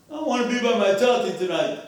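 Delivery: mock tearful kid; crying little boy. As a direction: imitate a little boy pleading through tears. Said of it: "he starts crying".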